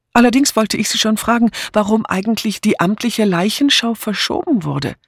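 high-pitched, insistent lady's voice; inquisitive Mrs. Easterbrook. A narrator's reading. Mrs. Easterbrook asking about the postponed inquest, high and inquisitive.

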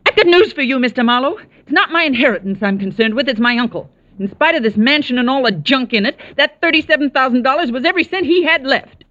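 high, indignant tirade at Marlowe, voice cracking; furious outburst. Acted. Niece snaps at the detective for insulting her, furious. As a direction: blaze up, offended and shrill with hurt pride.

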